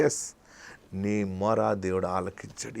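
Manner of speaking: usual speaking voice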